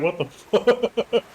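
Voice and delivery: slow, stretched what the fuck; drawn-out disbelief. Retold or imitated. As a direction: drag it out, stunned. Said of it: "even Wizard was like".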